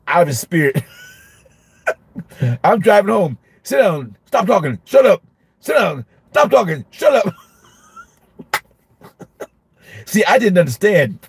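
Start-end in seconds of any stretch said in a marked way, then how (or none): 3.6-7.3 high-pitched barked commands, climbing on the last; mock snapping orders. Teacher driving home still barking at the class, mock angry. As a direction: keep firing classroom orders out of habit.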